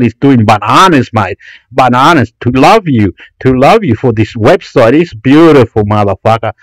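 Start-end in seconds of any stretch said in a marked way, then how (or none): none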